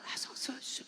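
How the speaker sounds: voiceless, urgent stage prompt; whispered prompting. Retold or imitated. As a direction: whisper it like feeding a forgotten line. Mimes prompting child she couldn't prompt.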